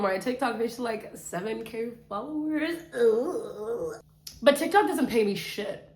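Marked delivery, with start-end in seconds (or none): none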